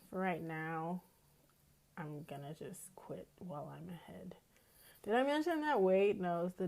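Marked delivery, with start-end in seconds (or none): 2.0-4.3 soft whispered aside. Real, not acted. Aside about quitting game.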